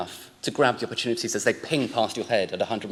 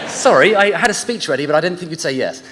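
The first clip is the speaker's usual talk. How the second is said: laughing; 5 semitones higher, 7 dB louder than usual. Real, not acted. Still laughing at story.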